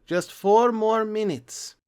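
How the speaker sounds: put-on high, slow whiny kid voice; Junior pleading. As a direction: stretch it out like a kid begging to stay up. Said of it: "with Junior saying".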